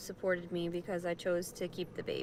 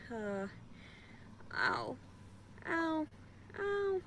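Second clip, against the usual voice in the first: ow, ow, rising higher each time in pain; 8 semitones higher, 6 dB quieter, much slower than usual. Real, not acted. Cries out in pain.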